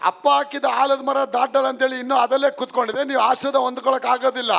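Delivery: high, insistent sermon delivery, building in force; fervent preaching. Real, not acted. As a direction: drive the message home to the congregation. (ಆವೇಶದ ಉಪದೇಶ)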